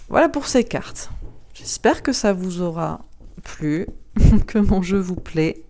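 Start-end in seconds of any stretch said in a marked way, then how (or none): 3.9-4.4 a quick bright laugh; short laugh. Real, not acted. Presenter wrapping up for viewers, short real laugh. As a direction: laugh lightly as you sign off. Laughs while closing the video.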